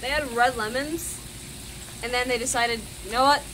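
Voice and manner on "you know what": usual speaking voice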